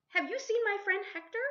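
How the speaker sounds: Juna's voice. Acted. Girl calling to the howler monkeys, high and loud. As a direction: pitch it way up and call out to the jungle. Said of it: "she asked them all".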